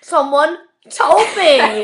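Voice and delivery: slow, loud laughing protest. Real, not acted. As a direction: laugh it out slowly. Laughing defence.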